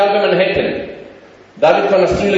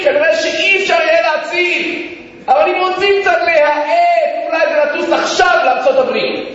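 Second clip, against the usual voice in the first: higher, urgent preacher's cry; impassioned preaching; 7 semitones higher than usual. Real, not acted. Preacher telling of results found too late, impassioned preaching.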